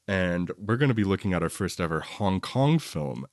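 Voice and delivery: usual speaking voice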